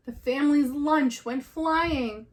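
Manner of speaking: ordinary delivery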